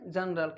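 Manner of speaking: जनरल said low with j; soft g. Shown as a demonstration. Teacher drilling the word: soft j. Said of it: "क्या बोलेंगे".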